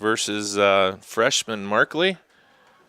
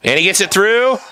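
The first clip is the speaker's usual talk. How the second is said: sudden high, loud goal shout; excited goal call; 9 semitones higher, 7 dB louder, faster than usual. Real, not acted. Goal call, live commentary.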